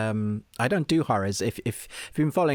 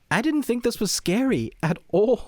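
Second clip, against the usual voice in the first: amused; 12 semitones higher than usual. Real, not acted. Laughing about horror film.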